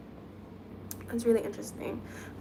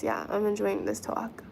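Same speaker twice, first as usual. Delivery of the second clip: ordinary talk, nothing marked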